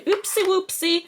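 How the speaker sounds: put-on high, cutesy baby voice; cutesy oopsie. Vlogger makes light of a spill, cutesy baby voice. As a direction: play it cute like a toddler.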